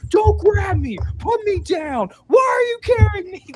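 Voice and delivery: high mock shouting, indignant; panicked protest. Film character being carried off from the presentation, shouting in protest. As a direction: imitate someone freaking out, pitch shooting up. Acting out character freaking out, grabbed.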